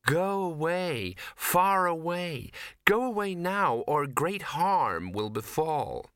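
possessed, otherworldly command; entranced warning. Acted.

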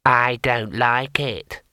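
slow, uneasy little imp; grumbling tiny demon; small imp voice. A narrator's reading. Picture imp speaks.

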